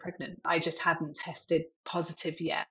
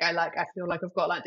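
ordinary talk

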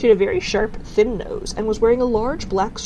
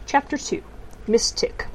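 ordinary delivery, nothing marked

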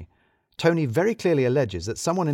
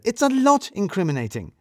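high, loud sardonic correction; dry retort; 10 semitones higher, 5 dB louder than usual. Acted. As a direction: top her remark with irony.

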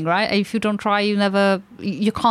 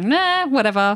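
mock dismissive; 3 semitones higher than usual. Imitates indifferent Londoners.

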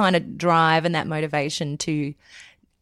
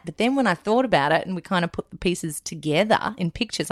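usual speaking voice

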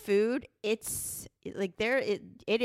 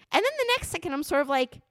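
ordinary talk, nothing marked

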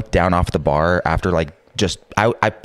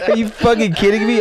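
laughing. Retold or imitated. Drunk disbelief laughing.

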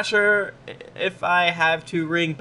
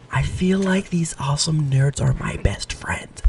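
breathy mock-ASMR whisper. Host reading a fan review as a joke ASMR whisper. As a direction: hush it like an ASMR video.

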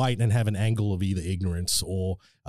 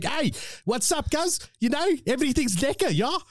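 put-on Cape Town accent. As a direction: greet the crowd in a Cape Town coloured accent. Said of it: "I could do the Cape Town accent".

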